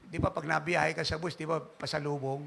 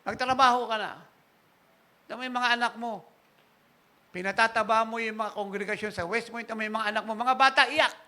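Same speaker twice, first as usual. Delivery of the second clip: higher, louder mock scolding; scolding wife; 5 semitones higher, 5 dB louder than usual. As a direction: chew him out about feeding the kids.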